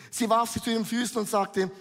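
ordinary talk, nothing marked

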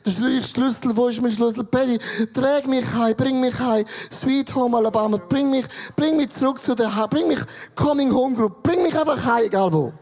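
put-on loud drunken pleading. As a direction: beg like a wasted friend. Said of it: "Wenn man betrunken ist, sagst du".

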